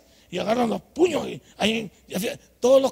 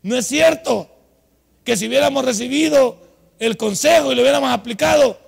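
louder, higher preaching cry; preaching shout; 4 semitones higher, 8 dB louder than usual. Acted. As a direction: drive the point home to the congregation. Predica a toda fuerza. (predicación a gritos)

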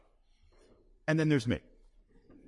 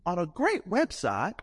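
slow, pitched-up Georgia lady, proud of the website; Southern lady voice; 5 semitones higher, much slower than usual. Retold or imitated. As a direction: drawl it out sweetly. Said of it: "She goes".

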